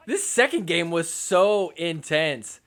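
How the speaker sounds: ordinary delivery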